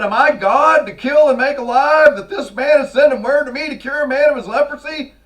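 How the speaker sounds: high, loud frantic wail; hammed-up panicking king; panicked king. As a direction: throw up your hands in panic as the king. Preacher acts out panicked king.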